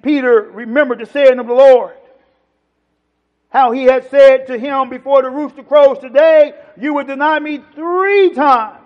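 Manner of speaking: loud pulpit declamation; emphatic, ringing preacher's delivery; forceful scripture reading. Real, not acted. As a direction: read the verse out hard to the congregation. Scripture read at full force.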